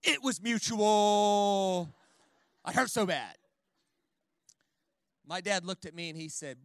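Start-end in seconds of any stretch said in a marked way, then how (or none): 0.0-3.3 slow, loud, hammed-up sobbing plea; mock wailing. Teen son wailing to his dad, mock sobbing. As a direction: wail it out like a heartbroken teen. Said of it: "I was like".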